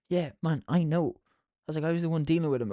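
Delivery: retold exasperated retort. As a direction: argue back at a scolding boss. Retold argument back at shouting manager.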